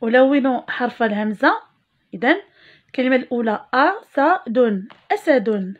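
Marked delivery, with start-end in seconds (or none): 3.7-4.9 أسد stretched slowly; teacher drawing out أسد; slow model word. Shown as a demonstration. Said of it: "المقطع الأول".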